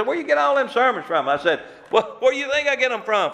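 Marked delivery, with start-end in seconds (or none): 0.0-1.3 mock question. People asking the preacher. 1.9-3.3 retold comeback.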